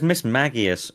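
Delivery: MAGIUS as "MAG-ee-us", hard g; first pronunciation variant. Shown as a demonstration. Listing variants for co-host, Magius with hard g. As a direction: stress a short MAG in Magius, g as in bag. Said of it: "and Miss Magius".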